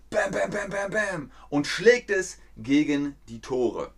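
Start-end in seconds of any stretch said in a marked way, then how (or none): 0.1-1.3 hammer sounds. Retold or imitated. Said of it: "Nagel und Hammer".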